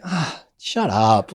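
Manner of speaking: slow mock shut up; slow, wry dismissal; dismissive. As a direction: roll your eyes at him. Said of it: "you're just like".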